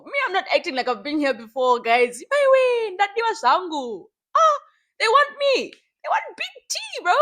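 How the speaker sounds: excited show-off shout; hyped boasting. Real, not acted. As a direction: hype yourself up. Boasting about being wanted.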